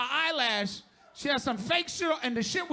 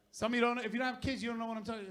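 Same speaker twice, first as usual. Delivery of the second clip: normal talking voice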